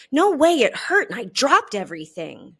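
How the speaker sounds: loud sharp retort; angry snap. A narrator's reading. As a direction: bite the words off. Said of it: "Schubert snaps".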